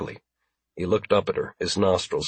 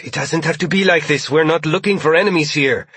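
high, urgent appeal; earnest hostage's raised voice; pleading; 6 semitones higher, 3 dB louder than usual. A narrator's reading. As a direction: plead with the captor to see reason. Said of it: "Marie pleaded".

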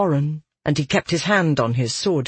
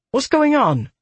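lightly voiced Holly, pitched up; a worried, high woman's voice; 10 semitones higher, 3 dB louder than usual.

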